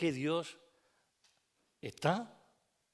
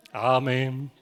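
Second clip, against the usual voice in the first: laughing amen; 8 semitones higher than usual. Real, not acted.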